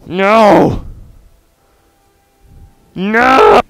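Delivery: loud, high cry of dismay; frustrated no; dismayed shout. Real, not acted. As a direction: yell at the crash. Upload crashed the game.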